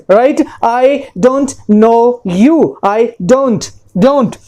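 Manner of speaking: ordinary talk, nothing marked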